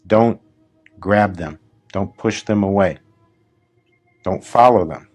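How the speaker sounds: ordinary talk, nothing marked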